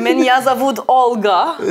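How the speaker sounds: mock Russian accent. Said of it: "mehr Russisch kann ich nicht".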